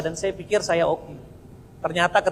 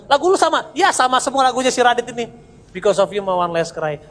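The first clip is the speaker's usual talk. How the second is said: mocking ex-girlfriends; 6 semitones higher, 6 dB louder than usual. Ex-girlfriends mocking his song.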